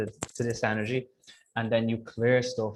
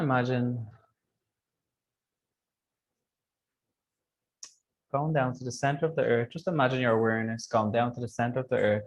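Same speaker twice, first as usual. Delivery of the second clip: guided meditation. Acted. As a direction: gently guide them through visualization.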